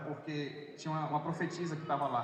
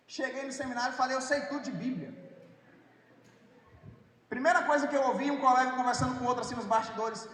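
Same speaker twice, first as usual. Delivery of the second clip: preaching at full force; 7 semitones higher than usual. Acted. Preacher to the congregation, retelling his seminary shock, fervent preaching. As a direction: drive the story home to the church.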